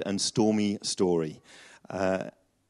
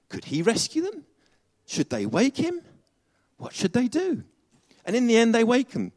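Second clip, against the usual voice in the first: ordinary talk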